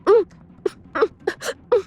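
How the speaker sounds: high muffled hums through a gag; muffled gagged moans. A narrator's reading. Gagged heroine moans through the cloth, high muffled hums. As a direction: struggle against the cloth. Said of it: "अरण्या बस".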